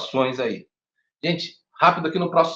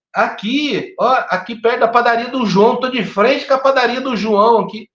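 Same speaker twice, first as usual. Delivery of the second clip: much higher-pitched mock caller; imitated emergency caller; 9 semitones higher, 5 dB louder than usual. Teacher imitating a caller giving a vague location, higher-pitched mock insistence. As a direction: insist on the spot in a raised, higher voice. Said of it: "elas falam assim".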